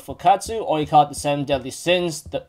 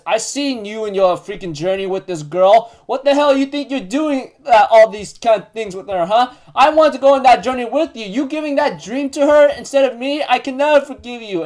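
higher, heated retold rant; venting frustration; 6 semitones higher than usual. Elaine vents at Bond over his journey, frustrated. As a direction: act out a jealous, frustrated outburst. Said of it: "venting her frustration towards Bond".

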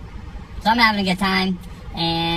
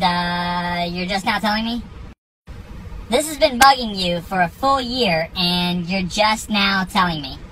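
usual speaking voice

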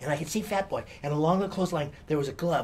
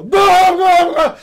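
high, loud startled yelling; frightened yell; 12 semitones higher, 18 dB louder, much slower than usual. Retold or imitated. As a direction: yell back in shock. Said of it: "hear the scream and go".

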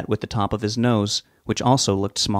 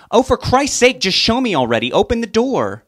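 much higher and louder, fed up; exasperated shout; 12 semitones higher, 5 dB louder than usual. Acted. Wife yelling through the door at her husband, exasperated. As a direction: explode with impatience.

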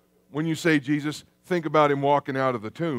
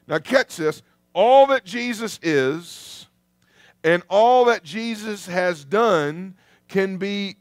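ordinary talk, nothing marked

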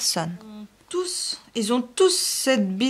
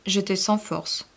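usual speaking voice

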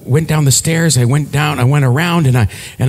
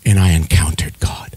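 hushed whisper of awe; 9 semitones lower than usual. Real, not acted. Preacher telling congregation his encounter, whispering. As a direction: drop to a whisper at the climax.

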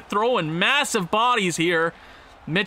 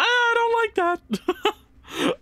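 laughing whine; 13 semitones higher, much slower than usual. Real, not acted. Laughing distress at a goal against.

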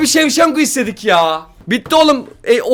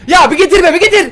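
pleading shout; 9 semitones higher, 7 dB louder than usual. Real, not acted. Begging the pack.

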